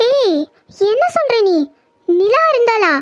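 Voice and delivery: high, startled questions; can't believe what she is hearing; shocked disbelief. Acted. Sister hears that Nila caught the girl, startled.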